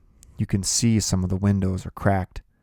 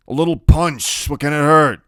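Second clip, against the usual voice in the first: higher and louder, anxious shrug; nervous; 8 semitones higher, 9 dB louder than usual. Acted. Iggy nervously pleading with Kenny over the punch. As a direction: wave it away, anxious to please. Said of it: "he sounds real nervous".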